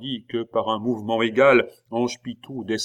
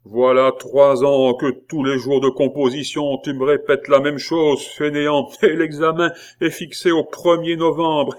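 louder, exasperated lecture; scolding abbé; 5 semitones higher, 6 dB louder than usual. A narrator's reading. Abbé scolding Pitou about the exam. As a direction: scold the lazy pupil.

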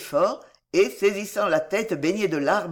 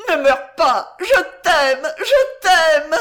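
very high, loud, desperate cries; Juana frantic; 10 semitones higher, 10 dB louder, slower than usual. A narrator's reading. Juana begs Chico not to die, frantic crying out. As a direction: beg wildly, climbing higher. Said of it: "cria Juan à demi folle".

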